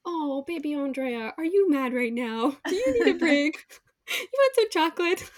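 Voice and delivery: put-on high, playful baby-talk with laughter; put-on sing-song parent soothing a little one. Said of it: "allowing myself to just be a child".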